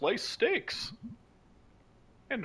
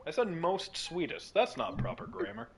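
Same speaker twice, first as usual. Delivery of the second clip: ordinary talk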